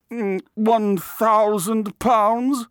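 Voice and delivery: very high, loud, drawn-out repeat of the price; pondering artist. A narrator's reading. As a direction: mull it aloud slowly in an affected high voice.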